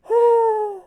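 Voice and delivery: very high, loud startled gasp; shocked gasp. Retold or imitated. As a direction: suck in air in shock at yourself. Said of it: "and then she goes".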